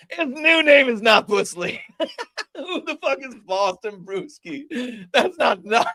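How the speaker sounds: high, laughing through the words; can barely talk for laughing; laughing hard. Real, not acted. Host reads guest's new name, laughing hard. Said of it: "I'm crying".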